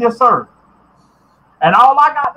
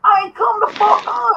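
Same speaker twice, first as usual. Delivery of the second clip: higher, angry exasperated yell; real frustrated outburst; exasperated shout; 7 semitones higher than usual. Real, not acted. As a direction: blow up at the stupidity.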